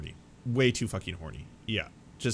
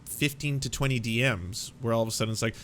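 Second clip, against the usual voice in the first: ordinary delivery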